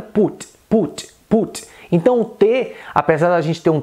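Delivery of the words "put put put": "put" with a clearly released final T; Indian English accent. Shown as a demonstration. Teacher showing the final T, Indian accent. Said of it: "soa no inglês indiano".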